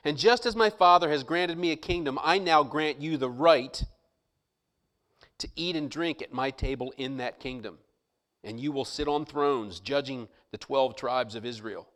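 measured reading of the verse; scripture reading. Acted. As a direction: read it out with weight. Reading scripture aloud in sermon.

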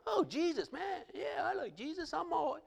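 high, airy mock voice of a lukewarm believer; high mock casual churchgoer; mocking shallow believer. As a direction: lampoon a half-hearted fan of Jesus.

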